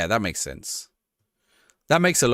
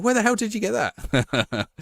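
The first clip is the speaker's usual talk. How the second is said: a much higher, slow put-on Canadian accent; 12 semitones higher, slower than usual. Canadian fan at the resort asking about the shirt, mock Canadian accent. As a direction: do the Canadian guy's line in his Canadian accent, high and drawn out. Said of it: "he said it in a Canadian voice".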